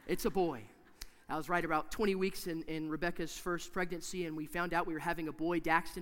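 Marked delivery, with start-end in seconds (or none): none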